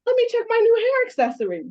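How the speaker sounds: fast, bubbly self-mockery; playful excitement. Retelling her morning, a quick, playful, high excited voice. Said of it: "I'm like".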